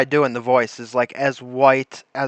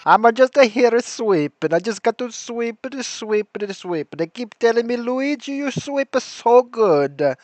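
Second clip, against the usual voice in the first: put-on higher, playful Italian accent spoofing the sweeper; mock Italian accent; 5 semitones higher than usual. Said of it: "I love that accent".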